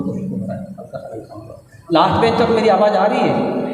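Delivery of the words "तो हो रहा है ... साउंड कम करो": whispers a quick request to the sound desk; hushed aside to the technician; whispered aside. Real, not acted. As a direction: drop to a breathy side whisper, off-mic. Aside to sound operator mid-lecture.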